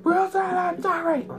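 high, loud hammed-up wail; mimicked movie shout. Bunkmate in boot camp mocking him, loud wail. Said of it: "And he's like".

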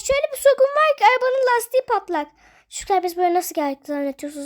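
ordinary delivery

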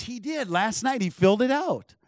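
high-pitched imitation of an astonished wife; excited, disbelieving quote; retold amazement. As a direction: jump up high, as if stunned it's true.

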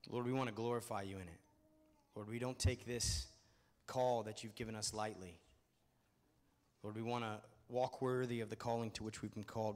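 earnest, steady prayer voice. Real, not acted. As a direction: pray it over them sincerely. Leads a prayer.

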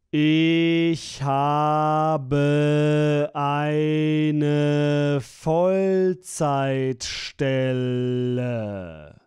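ordinary talk, nothing marked

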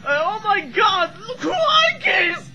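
high, loud, excited fireworks yell; excited shout. Real, not acted. Watching fireworks, shouting excitedly to viewers. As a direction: shriek it out at the exploding fireworks. Reacting live to fireworks display.

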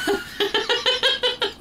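high, rapid staccato cackle; high giggling laughter. Real, not acted. Player amused by own joke on theft, high giggling laugh.

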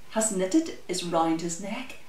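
plain reading voice

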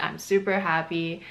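ordinary delivery

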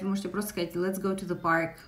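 ordinary delivery

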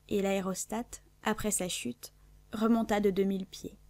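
ordinary delivery, nothing marked

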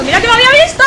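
high yelled frustration at yourself; frustrated shout. Real, not acted. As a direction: blurt it out furious with yourself. Frustrated outburst at losing. (grito de frustración)

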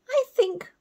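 high, bossy big-sister owl; Sarah the owlet. Acted. Sarah tells the others to share her branch, high. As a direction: take charge as the eldest. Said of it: "said Sarah".

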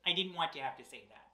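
fast, soft embarrassed aside; quiet aside. Real, not acted. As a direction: mumble it quickly.

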